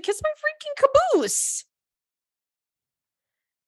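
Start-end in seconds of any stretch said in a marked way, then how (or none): 0.0-1.6 shrill-high sassy squeal; squeaky high mock voice. Retold refusal to a bride.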